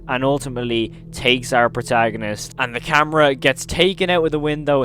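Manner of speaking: ordinary talk, nothing marked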